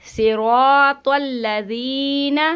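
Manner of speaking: normal talking voice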